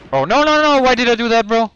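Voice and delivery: high, loud panicked outburst. Real, not acted. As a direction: yelp it out in panic. Panicked gamer reacting to mistake.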